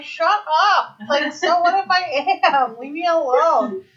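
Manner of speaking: high, drawn-out mock annoyance; imitating annoyed mom. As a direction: play an exasperated mom telling off her kid. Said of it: "used to get so mad at me".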